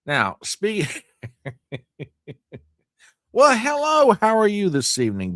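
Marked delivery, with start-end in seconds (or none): 3.3-5.4 bright greeting. Real, not acted. Greeting a new arrival.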